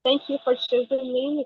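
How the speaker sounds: ordinary talk, nothing marked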